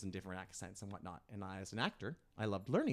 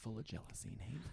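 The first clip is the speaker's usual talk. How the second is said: whispered under the breath; hushed aside; 11 dB quieter than usual. Real, not acted. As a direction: drop to a whisper for a teasing aside. Teasing aside about jealous ex-boyfriend.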